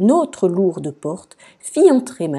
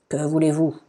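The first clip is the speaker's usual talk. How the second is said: usual speaking voice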